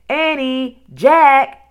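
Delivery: high, loud, drawn-out names; calling out. A narrator's reading. Mother calls her children home from the house, calling loudly. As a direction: call children in from afar. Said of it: "she called".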